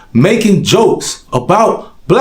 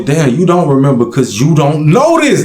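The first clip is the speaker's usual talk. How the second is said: ordinary talk, nothing marked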